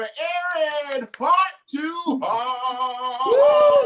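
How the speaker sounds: loud, hyped announcer call; hype shout. Real, not acted. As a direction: pump up the crowd. Host hyping the crowd.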